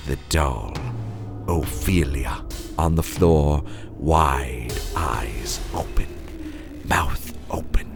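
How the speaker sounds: ordinary delivery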